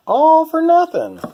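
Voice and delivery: high, loud cry of despair; exasperated. Real, not acted. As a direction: throw up your hands, all that work wasted. Exasperated at ruined paint job.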